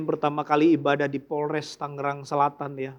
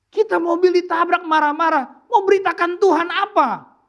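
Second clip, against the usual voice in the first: preaching rebuke; 10 semitones higher than usual. Real, not acted. Preacher rebuking angry Christians.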